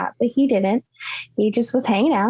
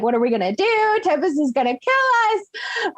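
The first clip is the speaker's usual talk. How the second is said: mock frantic; 8 semitones higher than usual. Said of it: "like, frantic".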